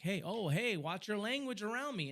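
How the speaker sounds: joker tech guy. Retold or imitated. Tech guy teasing him at the door, jokey. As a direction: imitate a would-be funny guy's high mock warning. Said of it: "he's always trying to be all funny".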